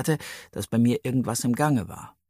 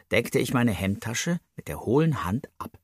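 ordinary delivery

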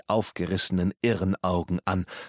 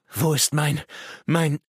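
high, louder, agitated plea; frantic searching; 9 semitones higher, 4 dB louder than usual. Acted. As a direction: search desperately, breaking off. Madman frantically searching for his box. (panisch und aufgeregt suchend)

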